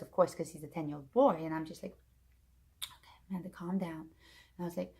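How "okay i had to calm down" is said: whispered, breath-out reenactment; hushed self-soothing; calming down. Retold or imitated. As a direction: whisper it softly to settle yourself. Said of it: "I had to calm myself down".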